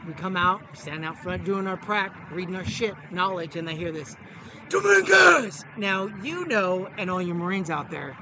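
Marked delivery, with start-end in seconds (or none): 4.7-5.6 high, loud drill-instructor call; sharp imitation of a drill instructor's yell. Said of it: "you know your drill instructor's voice".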